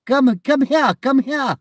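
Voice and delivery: high loud call to get attention; loud high-pitched call; calling out loudly. Real, not acted. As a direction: shout across to a stranger. Calling out to man outside.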